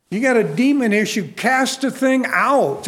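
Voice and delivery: full-force preacher's command; forceful preaching. Real, not acted. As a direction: preach it hard and high. Preacher urging deliverance.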